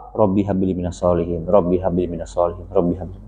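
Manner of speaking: put-on low, soft prayer murmured over a child. Shown as a demonstration. Preacher showing parents how to pray over a child's head, softly. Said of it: "Jangan kenceng-kenceng".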